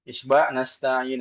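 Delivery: nasta'in with the long vowel held for six counts; long isba reading. Shown as a demonstration. Teacher demonstrating isba' length to santri, drawn out. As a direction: stretch the ending out fully.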